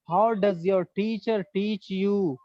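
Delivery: very slow, every word stretched for students; slow model sentence. Shown as a demonstration. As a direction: dictate "How does your teacher teach you?" slowly. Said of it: "teacher models English translation slowly". (धीरे-धीरे, हर शब्द खींचकर)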